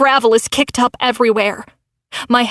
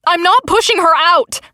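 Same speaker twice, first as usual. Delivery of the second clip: higher, stressed not in protest; yelling; 6 semitones higher than usual. A narrator's reading. Narrator refuses Crystal's order, defiant yell. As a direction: refuse hard, hitting not. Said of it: "I yell".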